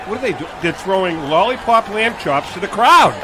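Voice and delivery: ordinary delivery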